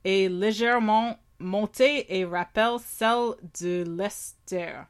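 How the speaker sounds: careful slow French reading. Shown as a demonstration. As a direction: read the label aloud in French. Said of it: "Read it en français".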